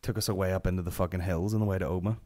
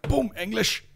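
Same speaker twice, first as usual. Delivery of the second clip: sudden leap way up, louder; triumphant cry; 14 semitones higher, 4 dB louder, slower than usual. Retold or imitated. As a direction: punch it like a victory. Triumphantly picks language on pump.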